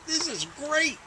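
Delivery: high-pitched mock delight; delighted exclamation. Boy writes down his frog experiment result, delighted exclamation.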